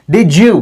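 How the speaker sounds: "did you" said as di-joo; blended J sound. Shown as a demonstration. Teacher modelling the jeem sound, blended. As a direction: run "did you" into one word with a J. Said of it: "जीम का जो sound है".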